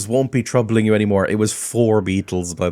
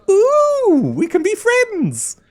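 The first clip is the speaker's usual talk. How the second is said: very high-pitched delight; gleeful high squeal, drawn out; excited squeal; 3 dB louder, much slower than usual. Real, not acted. Player delighted at making a friend, excited squeal.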